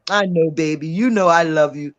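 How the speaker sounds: low, soothing sweet talk; affectionate reassurance. Real, not acted. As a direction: drop low and reassure fondly. Soothing co-host after ribbing him.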